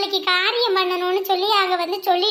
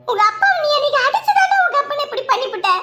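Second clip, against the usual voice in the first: raised, impassioned blame; anguished accusation; 4 semitones higher than usual. Acted. Mother blames son for his father's death, anguished accusation. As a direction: hurl the blame, voice climbing to a high peak.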